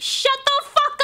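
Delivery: very high, snapping yell; mock angry yell. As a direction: shout them down in fake rage. Yelling at customers.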